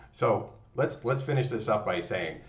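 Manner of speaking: normal talking voice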